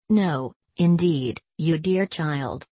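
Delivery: usual speaking voice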